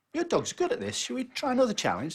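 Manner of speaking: squeaky pub landlord proposing a bet; bartender voice. Acted. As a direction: play the barman high and chirpy.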